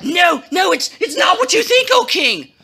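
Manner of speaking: high, stammering mock plea; panicked pleading. A narrator's reading. Haman pleading with the king, hammed-up panic. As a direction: beg frantically, voice jumping up.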